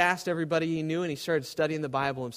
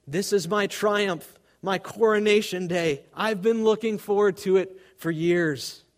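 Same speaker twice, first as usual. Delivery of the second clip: higher, louder and slow, a jubilant cry; exclaiming in triumph; 6 semitones higher, 7 dB louder than usual. A narrator's reading. Dying preacher exclaims his coronation day, triumphant. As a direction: proclaim it like a crowning moment. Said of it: "he exclaimed".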